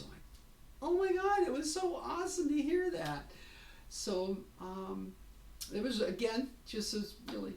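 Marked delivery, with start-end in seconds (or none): 0.8-3.3 very high, loud burst of delight; re-enacted overjoyed reaction; thrilled gushing. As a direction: gush with joy, pitched way up. Retold thrilled reaction to hair news.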